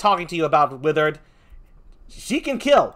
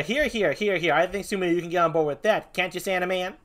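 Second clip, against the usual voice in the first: ordinary talk, nothing marked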